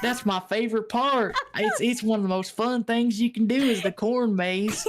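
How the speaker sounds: imitated keen Southern owner, amused; Southern accent. Owner praises his favorite attraction, in a Southern accent. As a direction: sell it like a proud Southern local. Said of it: "He talked like this".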